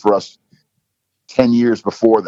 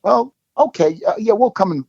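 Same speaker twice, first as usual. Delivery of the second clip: higher, halting mock surprise, imitating his own hesitation; caught off guard; 11 semitones higher than usual. Retelling how he reacted to the coach's invite, higher, in hesitant mock surprise. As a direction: stammer it out like you've been cornered into agreeing. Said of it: "And I'm thinking".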